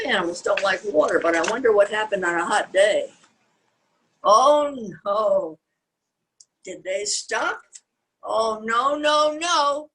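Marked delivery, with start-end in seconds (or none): none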